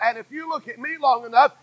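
usual speaking voice

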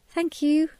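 small shy thanks; shy little girl. A narrator's reading. Janet thanks the tea shop owner, shy high child voice. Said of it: "Janet said shyly".

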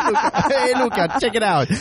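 hammed-up Italian dad bragging; fake Italian accent. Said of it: "fake English accent doing a fake Italian accent".

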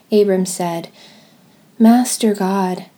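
normal talking voice

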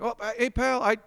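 high, teasing radio-host voice; spring the surprise with a grin in the voice; Bernie impression. Radio host Bernie springs a surprise caller on Martin. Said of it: "And he's like".

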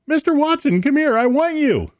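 very high, mock excited call; excited shout. Host voices Bell calling Watson on first telephone, excited shout. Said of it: "In an excited voice, he shouts".

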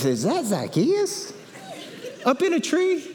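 drawn-out, high-pitched incredulous crowd; mock disbelief. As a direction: question rising sharply into comic astonishment. Crowd spots Zacchaeus in the tree.

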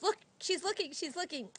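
high, urgent retold protest; mock alarm. Husband catches baby peeking at them, high mock alarm. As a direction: imitate a flustered husband, pitched well up.

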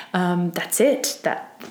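usual speaking voice

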